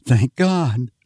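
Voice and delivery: lightly voiced sigh of relief; relieved thanks. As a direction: let the tension drop out as he says it. Lois sags in relief.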